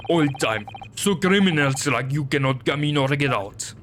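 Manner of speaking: gruff Russian sailor, proud; broken English with a heavy Russian accent. Acted.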